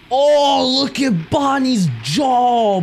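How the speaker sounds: high, loud and slow shocked exclaim; horrified disbelief drawn out; shocked gasp. Real, not acted. Gamer staring at Bonnie's jaw, loud shocked exclaim. Reacting to scary animatronic.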